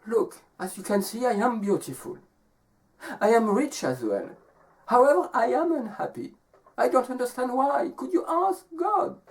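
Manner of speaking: high storybook woman, rising to a plea; unhappy young woman. Acted. Beautiful rich woman asking why she is unhappy, high plea. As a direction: lightly act her puzzlement, climbing in pitch. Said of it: "and she says".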